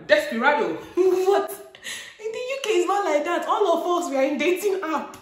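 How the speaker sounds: high, loud protest through laughter; incredulous laughing. Real, not acted. Vlogger defending dating app users, laughing incredulously. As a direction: squeal it in amused disbelief. Laughs at being called desperado.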